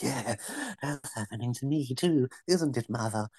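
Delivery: soft, creepy muttering. Pretend. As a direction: talk softly to an imagined mother. Schizo voice quoted, soft.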